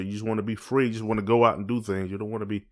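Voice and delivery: normal talking voice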